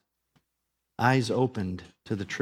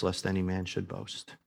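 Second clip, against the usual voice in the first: usual speaking voice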